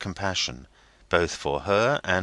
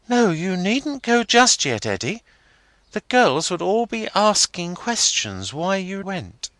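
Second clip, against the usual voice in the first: put-on high, girlish schoolgirl voice; high schoolgirl voice, teasing; young girl Rosa; 13 semitones higher, 5 dB louder than usual. A narrator's reading. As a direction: lift into a bright young girl.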